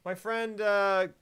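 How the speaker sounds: high, loud son's voice; voiced son. Son telling of his sick friend, high and loud. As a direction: play the son explaining himself, pitched right up. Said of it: "the son comes in, and he's like".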